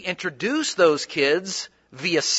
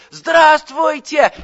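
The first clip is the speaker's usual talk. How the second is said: put-on "Здравствуйте!" shouted high and slow like a room of kids; kids shouting a greeting; 10 semitones higher, 6 dB louder, much slower than usual. Schoolkids shouting a greeting at a surprised Russian man on Skype. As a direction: chant "Здравствуйте!" loudly in unison, drawing it out. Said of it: "And all the kids go".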